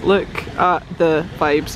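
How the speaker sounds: LOOK, AT, THE, VIBES word by word; slow right down, stressing every word; slow, deliberate savouring of each word; slow emphasis. Real, not acted. Repeats it slower for effect.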